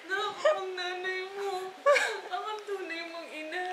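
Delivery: high, teary laugh breaking into words; overwhelmed mother laughing through tears. Real, not acted. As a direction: laugh and cry at once, slowly. Emotional laughing-crying at family moment.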